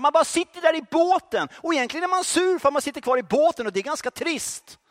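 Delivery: high, loud, driving sermon voice; fiery preaching. Acted. Preacher challenging the church to act, fiery preaching. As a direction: rouse the congregation, pushing hard.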